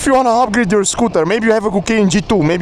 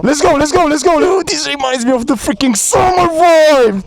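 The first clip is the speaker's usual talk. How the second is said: hyped cheering; 7 semitones higher than usual. Real, not acted. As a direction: cheer them on, pumped. Riding out, hyped.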